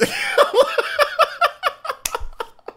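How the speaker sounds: loud, high laugh; big laugh. Real, not acted. Streamer still laughing about his age, loud laugh. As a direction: burst out laughing again.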